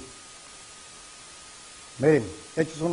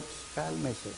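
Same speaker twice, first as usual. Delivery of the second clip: calming; 18 dB quieter than usual. Retold or imitated. Prophet calming his frightened servant.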